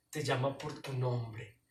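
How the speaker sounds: usual speaking voice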